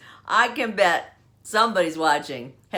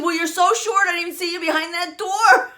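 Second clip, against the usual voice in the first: teasing daughter; 11 semitones higher, 6 dB louder than usual. Retold or imitated.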